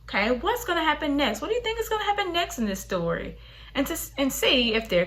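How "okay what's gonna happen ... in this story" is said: high, quick wondering voice; curious child thinking. Shown as a demonstration. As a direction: wonder aloud like a curious kid. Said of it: "Let them think".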